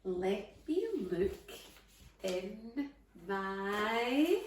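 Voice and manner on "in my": usual speaking voice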